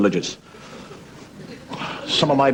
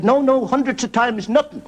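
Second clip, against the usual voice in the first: high put-on woman's voice; lightly voiced Irish mother; 12 semitones higher than usual. Irish mother correcting the Pope, lightly acted high voice. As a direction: deny it as the comic mother. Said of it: "She said".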